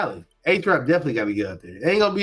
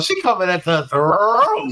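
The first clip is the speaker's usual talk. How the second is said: normal talking voice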